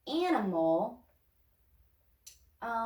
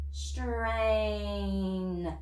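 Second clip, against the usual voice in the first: slow stretched blending. Shown as a demonstration. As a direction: Strain drawn out slowly, sound by sound. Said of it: "Say it fast".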